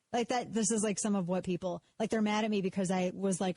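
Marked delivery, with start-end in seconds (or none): none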